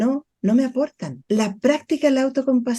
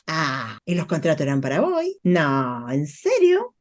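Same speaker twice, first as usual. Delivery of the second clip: put-on childish reply; 7 semitones lower, 3 dB quieter than usual. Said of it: "responde desde el niño".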